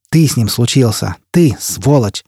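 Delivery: ordinary delivery, nothing marked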